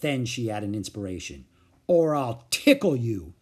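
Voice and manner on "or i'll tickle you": threatening. A narrator's reading. Sister's inspired threat.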